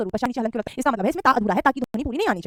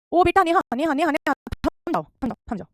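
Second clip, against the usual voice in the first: calling out to stop; 4 semitones higher than usual. Real, not acted. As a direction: call Neha to stop, then settle. Teacher stops a student. (Neha को रोकते हुए ऊँची पुकार, फिर धीमी)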